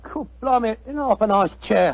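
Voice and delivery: a light cockney helper's reply; lightly put-on London cockney; cockney mate. Disguised Watson answering his partner in light cockney. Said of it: "What barbaric jargon do you speak?".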